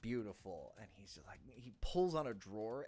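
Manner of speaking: ordinary delivery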